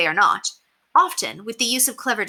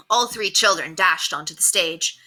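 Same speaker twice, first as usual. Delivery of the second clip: ordinary delivery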